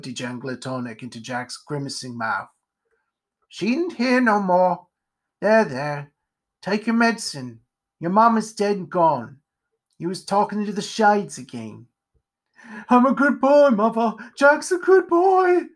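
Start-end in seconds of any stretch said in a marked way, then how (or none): 3.5-11.9 gruffly soothing, slightly raised pitch; matter-of-fact character comforting; consoling spider character. Acted. Spider soothes the raving pumpkinhead. 12.9-15.7 high, loud tearful wail; anguished pitched-up protest to mother; crying. Acted. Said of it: "He was crying".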